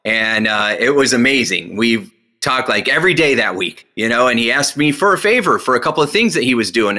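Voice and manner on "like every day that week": ordinary talk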